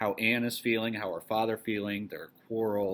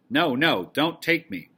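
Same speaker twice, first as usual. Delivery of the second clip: loud, high, panicked cry from a nightmare; screaming in terror; 6 semitones higher, 8 dB louder, slower than usual. A narrator's reading. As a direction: scream it like a girl waking in terror. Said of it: "we hear Anne scream".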